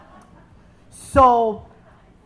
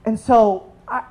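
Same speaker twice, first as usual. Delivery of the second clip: ordinary talk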